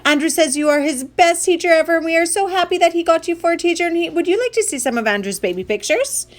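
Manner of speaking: high, rapid, overexcited greeting; embarrassingly eager mom, high and rushing; gushing mom's yell. Acted. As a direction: call out, then gush without stopping. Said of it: "his mom yelled".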